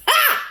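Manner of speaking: sudden high whoop of laughter; loud laugh. Real, not acted. Bursts out laughing.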